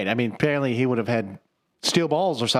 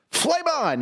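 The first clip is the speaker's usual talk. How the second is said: much higher mock battle call; mock hero cry. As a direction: shout it like a showoff hero. Human Torch line quoted.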